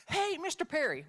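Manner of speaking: loud, high calling out; excited student. Retold or imitated. Student calling to co-teacher, excited. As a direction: holler to a teacher with big news. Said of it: "he was so excited".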